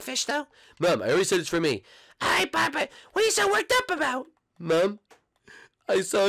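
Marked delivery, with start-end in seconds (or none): 2.2-4.3 high cartoon mom voice; sing-song motherly cartoon voice; mother greeting her son. Plays Marge the mom.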